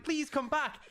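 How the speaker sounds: high, mock desperate plea; pleading. Watching the champion run off, a high mock plea. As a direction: beg him to come back in high pitched mock panic. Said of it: "I'm like".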